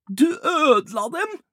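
high, loud, drawn-out protest; outraged accusation. Acted. Rolf blames Ibbi for the ruined cookies, outraged.

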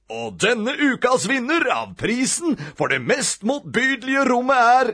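high, building game-show announcement; TV show announcer. A narrator's reading. TV presenter announces the winner.

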